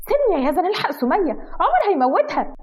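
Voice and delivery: high-pitched, alarmed plea; urgent pleading. Acted. Wife begs Yazan to save her pet, frantic.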